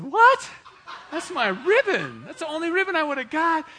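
high, loud, laughing disbelief; shocked outrage. Retold or imitated. Teen on podium told ribbons ran out, shocked protest. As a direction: cry out like the prize was stolen. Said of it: "I said".

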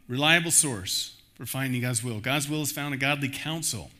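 ordinary talk

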